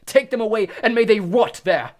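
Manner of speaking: loud furious roar. Acted. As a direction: roar at the Dementors, spitting. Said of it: "Crouch roared".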